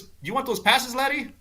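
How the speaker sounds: high-pitched put-on voice making an offer; high, friendly mock offer; Gary Player. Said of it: "Gary Player walks up and he".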